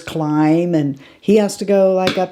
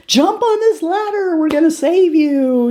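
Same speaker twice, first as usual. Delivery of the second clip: much higher pitched rescuer call; rescuers shouting up; 11 semitones higher than usual. Retold or imitated. Helicopter crew calling down to the man, high and urgent. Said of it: "they're like".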